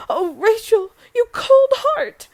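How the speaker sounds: loud, high, drawn-out reproach; wounded rebuke. Acted. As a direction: accuse your daughter, stretching the final words. Mother rebukes her daughter.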